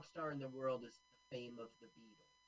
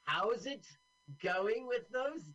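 apologetic upper-class shopkeeper; posh British accent; 3 semitones higher than usual. Pretend. Voicing British film characters.

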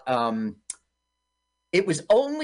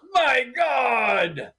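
shout; 7 semitones higher than usual. Retold or imitated. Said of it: "And he goes".

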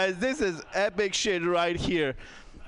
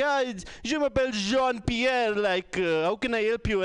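put-on higher-pitched French accent; 7 semitones higher than usual. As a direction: answer the phone as a French call-centre agent. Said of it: "a French guy would pick up the phone".

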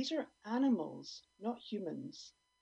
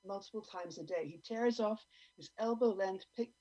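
ordinary talk, nothing marked

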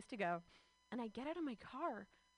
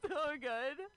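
nervous laugh; 3 semitones higher than usual. Retold or imitated. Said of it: "I'm like".

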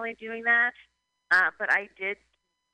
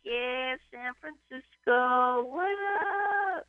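slightly higher, slow hype-man call; mock MC shout-out; 5 semitones higher, slower than usual. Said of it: "I'd be like".

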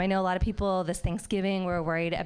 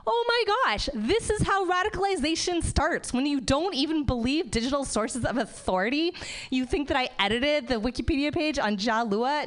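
high-pitched mock outrage; indignant outburst; 7 semitones higher than usual. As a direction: protest the accusation with theatrical disbelief. Acted indignant reply to husband.

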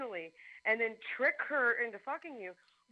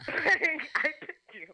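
laughing, slow and louder on I picked you; laughing through it; 6 semitones higher, 9 dB louder, much slower than usual. Real, not acted. Repeating her own line to the guy, laughing hard.

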